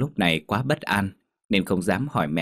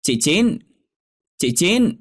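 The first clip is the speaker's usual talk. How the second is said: loud, high hail from outside; raised, rising call of a visitor; 10 semitones higher, 6 dB louder, slower than usual. A narrator's reading. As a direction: call to rouse someone dozing. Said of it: "nghe tiếng kêu".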